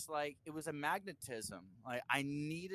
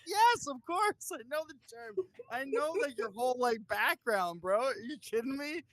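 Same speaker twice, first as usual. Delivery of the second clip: mock incredulous self; 10 semitones higher, 7 dB louder than usual. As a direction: play it as the stunned young fan. Retold reply to [name].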